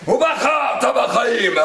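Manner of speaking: high, slow, loud, threatening bellow; mock stern God. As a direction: boom it like a God with a whip. Mocking God with a whip. (Dieu menaçant, moqueur, crié lentement)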